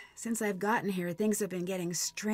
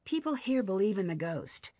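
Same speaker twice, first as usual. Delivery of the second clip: ordinary delivery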